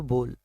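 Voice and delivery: बोल said simply with a long o; straightforward pronunciation. Shown as a demonstration. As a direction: say बोल as "bole", no diphthong. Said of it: "सीधा सीधा इसको बोलो".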